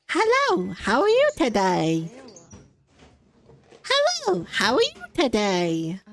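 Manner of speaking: put-on rising high-pitched kid's hello; mimicking a four-year-old's hello; kid voice imitation. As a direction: chirp it like a little kid.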